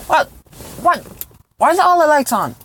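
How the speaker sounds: real shock at the lights; baffled outburst; startled. Real, not acted. Lights come on in the car.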